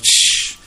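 loud hissed lightning noise; a sharp voiceless crack of lightning; lightning shh. Retold or imitated. Imitating lightning strike.

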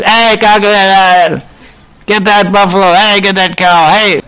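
high-pitched holler at the cattle; wild village yell. Shown as a demonstration. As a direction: demo a villager hollering across a field. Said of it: "little bit wild in a village".

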